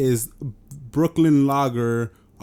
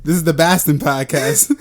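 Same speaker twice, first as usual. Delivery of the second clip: hammed-up Boston accent, raised and loud; bad Boston accent; 4 semitones higher, 6 dB louder than usual. Host jokingly renaming the show, loud bad Boston accent. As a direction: announce it like a fake Bostonian. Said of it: "We're not even doing the accent".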